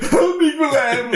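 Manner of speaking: high, squealed cry for help; mock desperate plea. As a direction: imitate a helpless climber begging his rival. Acts climber begging rival for help.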